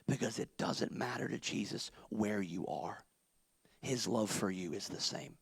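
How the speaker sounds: low, soft near-whisper; hushed reassurance. Acted. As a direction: whisper the comfort to them.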